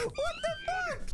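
high, slow shrieking disbelief; slow high outcry of disbelief; shocked outcry. Real, not acted. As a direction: cry out in disbelief. Shocked reaction to betrayal.

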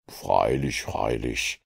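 low, amused agreement; a smile in the low voice; lightly amused Holmes; Holmes smiling. A narrator's reading. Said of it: "bestätigte Holmes lächelnd".

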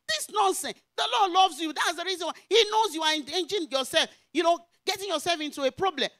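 loud, high, urgent reproof; preaching rebuke. Retold or imitated. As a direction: preach at her with force, re-enacting it. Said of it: "I began to preach to her".

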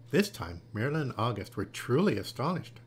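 ordinary delivery

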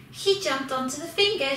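ordinary delivery, nothing marked

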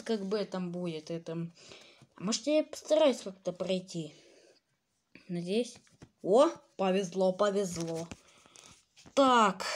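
ordinary talk, nothing marked

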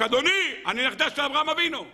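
grandmother shouting. Acted. Grandmother crying out before the students, high put-on shout. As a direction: call out to the rabbi as the proud granddaughter, pitched high. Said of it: "התחילה לצעוק".

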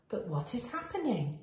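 ordinary delivery

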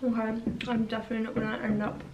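talking while chewing; muffled by food in mouth; mouth full of brownie. Real, not acted. Seen 1: Bites at -5; lips closed, chewing while holding brownie early on.

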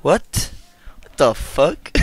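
ordinary talk, nothing marked

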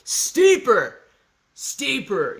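mock hill-shouting coach; imitated shout. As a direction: yell it at the hill. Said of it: "Johan shouting at the hills".

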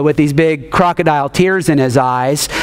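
usual speaking voice

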